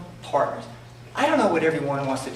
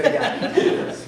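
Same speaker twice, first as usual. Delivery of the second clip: laughing; 6 semitones higher than usual. Real, not acted. Laughing through punchline.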